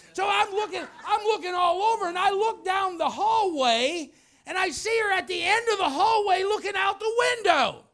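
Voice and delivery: loud, high, heated telling; shouting through the story in anger; angry boss telling how he hunted for her; angry search. Retold or imitated.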